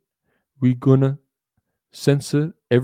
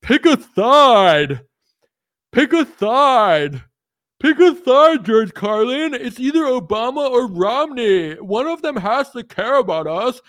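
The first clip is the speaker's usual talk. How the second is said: higher, louder, slow nagging mock voice; whiny partisan mock; 6 semitones higher, 3 dB louder, slower than usual. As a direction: whine it like a pushy partisan scolding Carlin. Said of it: "they're like".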